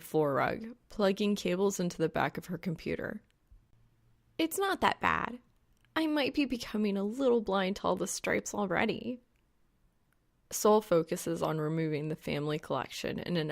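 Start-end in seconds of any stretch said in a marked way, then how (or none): 4.4-9.2 lightly voiced young woman, higher pitch; a teasing girl shrugging it off, pitched up; Reaper's voice. A narrator's reading.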